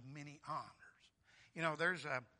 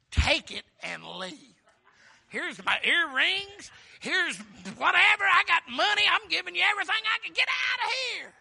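frantic shouting; 19 dB louder than usual. Acted. Preacher acts Egyptians driving Israel out, frantic shouting. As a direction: yell it loud and high, stretching the words.

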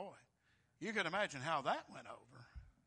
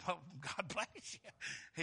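laughing; much slower than usual. Retold or imitated. Repeats blessing laughing.

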